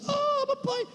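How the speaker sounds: put-on high whimpering little girl; crying child.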